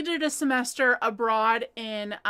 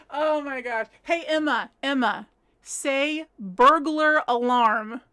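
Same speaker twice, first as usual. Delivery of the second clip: normal talking voice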